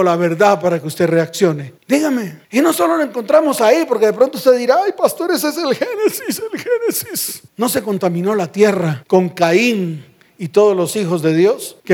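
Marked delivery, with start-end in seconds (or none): 4.8-7.4 very high-pitched mocking squeal; mock whining congregant. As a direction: mimic a silly objection in falsetto. Mimics a whining congregant objection.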